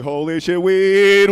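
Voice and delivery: building to a loud, drawn-out "weed"; awed exclamation. Retold or imitated. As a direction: burst out in mock awe. Acting out awe on walking in.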